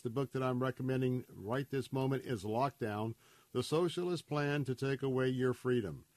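ordinary delivery

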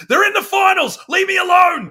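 put-on very high-pitched kid's scream; hammed-up, high screech of an absorbed child. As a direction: imitate a boy screaming to be left alone. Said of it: "And he just screamed".

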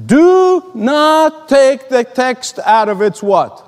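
"Do not take" drawn out slowly and pitched high; emphatic rule. Real, not acted. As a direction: hammer "Do not take the text" word by word, ending on a questioning "what". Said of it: "Let me repeat this".

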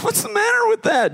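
high, squeaky mock surprise; puzzled onlooker. Student spotting the bruised arm, high squeaky surprise. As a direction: play the baffled student staring at the arm. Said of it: "and you're like".

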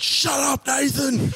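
mild mock irritated dad; a hint of an annoyed dad; mock dad snap. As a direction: snap it like a fed-up father, half-laughing. Dad snapping at son.

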